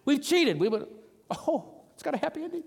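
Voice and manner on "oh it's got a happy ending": high, soft little gasp of delight; hushed, high-pitched pretend glee; mock delighted relief. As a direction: act a reader secretly thrilled by the ending. Acted reader peeking at the ending.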